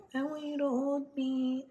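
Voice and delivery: tiny high-pitched mock whine; whiny, cutesy imitation. Shown as a demonstration. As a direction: whine it small, trying to be cute. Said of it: "You get, like, whiny, like, little".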